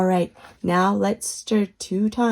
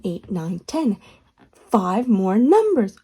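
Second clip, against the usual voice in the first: ordinary delivery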